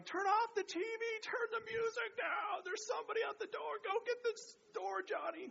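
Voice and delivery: high mock-yelling mom. Mom yells at the kids to get the door, imitating. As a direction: holler like a frazzled mom. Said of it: "my mom's yelling".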